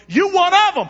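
high loud accusation; accusing bystander. Acted. Bystander accusing Peter, high and loud. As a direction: call him out.

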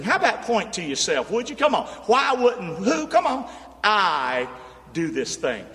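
ordinary talk, nothing marked

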